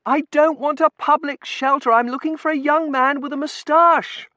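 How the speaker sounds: high, loud, urgent protest; screaming. A narrator's reading. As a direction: scream it over the din at an official. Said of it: "she was screaming at the warden".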